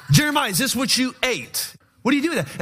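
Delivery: high, laughing disbelief at the prophet; incredulous laughing question. Retold or imitated. Confused reader asking Jeremiah about the verse, laughing and incredulous. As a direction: ask it like you can't believe what you read.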